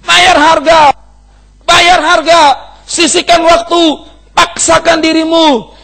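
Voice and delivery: loud, high, slow preaching cry; urgent shout. Real, not acted. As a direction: drive it home like a revival preacher. Preacher urging congregation loudly. (teriakan khotbah yang mendesak)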